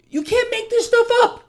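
very high-pitched exclamation; worked-up, high comic exasperation; incredulous. Acted. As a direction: squeal it in exaggerated disbelief. Mock disbelief at economy claims.

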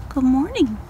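normal talking voice

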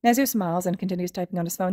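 ordinary delivery

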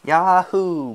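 high, loud whoop through a forced smile; strained imitation of a festive whoop; forced cheer. A narrator's reading. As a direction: holler back with put-on cheer. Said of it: "forcing a smile".

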